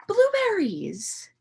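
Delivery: gasp, then a high slow "blueberries"; mock shock; gasping reveal. A narrator's reading. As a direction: build suspense for the kids. Dramatic reveal.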